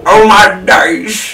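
high, loud, slow drawn out oh my days; hammed-up exclamation. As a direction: stretch it out like a theatrical lament. Trading mock exclamations.

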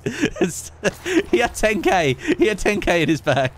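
high, laughing disbelief; cracking up, pitch jumping up; laughing while talking. Real, not acted. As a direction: squeeze the words out through laughter. Cracking up after the kill.

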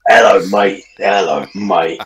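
low, drawn-out bloke greeting; put-on bloke voice. Mimicking blokes who sound like that, put-on bloke voice. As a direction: greet him like a deep-voiced local lad. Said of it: "you've got blokes that sound like that".

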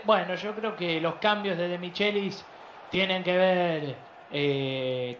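normal talking voice

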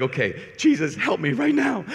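comic panicked prayer, rising high; mock desperate plea. A priest facing a ramped-up dad, high mock-desperate plea to Jesus. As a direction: plead for rescue, hammed up.

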